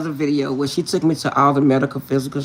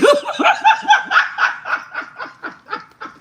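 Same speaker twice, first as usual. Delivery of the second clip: loud, high, long cackle of laughter; real helpless laughter; long high cackle at the story; 5 dB louder than usual. Real, not acted.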